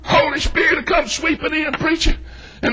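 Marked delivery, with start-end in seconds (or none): none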